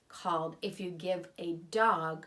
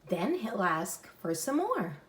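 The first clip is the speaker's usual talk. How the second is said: lively louder storybook read; reading to children; 5 dB louder than usual. Acted. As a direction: read it aloud for little kids. Reads picture book aloud.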